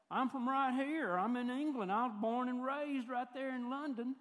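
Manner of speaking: loud, high Tennessee accent, hammed-up. Tennessean pretending to be a born Londoner, hammed-up Tennessee accent. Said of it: "You sound like you're from somewhere like".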